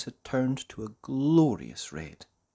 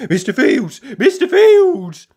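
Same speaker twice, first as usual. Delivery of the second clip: calling out; 13 semitones higher, 16 dB louder than usual. Acted. Mister Forgetful running into the farmyard, calling loudly for the farmer. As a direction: call across the farmyard. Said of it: "he called as he ran".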